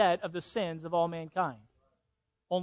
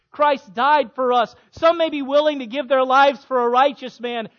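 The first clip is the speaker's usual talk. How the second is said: loud, rising preacher's crescendo; preaching at full force; 6 semitones higher, 9 dB louder than usual. Acted. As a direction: drive each phrase harder.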